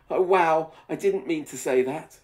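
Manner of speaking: loud mock surprise; surprised. Real, not acted. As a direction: exclaim at the line, amused. Reader reacts to own line.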